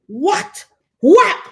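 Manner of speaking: indignant grandmother jumping in; shocked scolding. Retold or imitated. Grandmother shocked at her granddaughter's words, sharp rising what.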